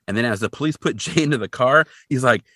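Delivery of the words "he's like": normal talking voice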